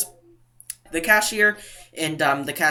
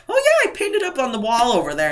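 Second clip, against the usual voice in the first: higher-pitched put-on cheery reply; imitated friendly cashier; 7 semitones higher than usual. Home-store cashier about an online compliment, imitated cheerily. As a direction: imitate a chirpy store worker.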